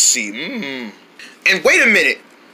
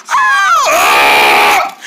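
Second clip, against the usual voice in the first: loud yell dropping very deep; strong angry yell; 5 dB louder than usual. Acted. Coached toward angry voice, loud deepening roar. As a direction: roar it down to a low growl. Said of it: "Come on, strong violence".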